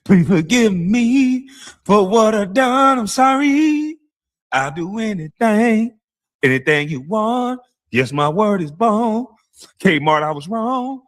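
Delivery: high, slow, whiny begging voice; mock pleading apology. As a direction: plead like a pitiful crybaby. Mocking a tearful apology.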